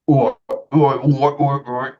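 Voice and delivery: imitating a young Cockney lad; Cockney accent. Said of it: "moment of impression".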